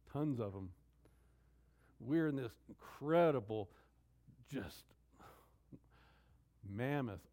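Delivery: ordinary delivery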